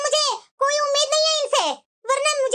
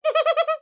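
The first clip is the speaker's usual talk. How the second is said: mocking laugh; 2 semitones higher than usual. Daughter mocks sister-in-law's offer.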